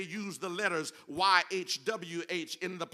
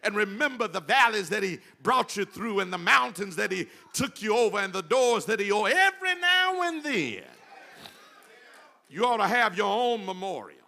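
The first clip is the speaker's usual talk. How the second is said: rising, louder, fervent sermon cadence; full-force preaching; 2 semitones higher, 7 dB louder than usual. Acted. Preacher urging the congregation to keep memorials, fervent preaching.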